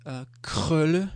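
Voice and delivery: "krølle" with a throaty back r, rising at the end; Danish word demo. Shown as a demonstration. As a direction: say "krølle" slowly, as a model for a learner. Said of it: "we can practice with these two words".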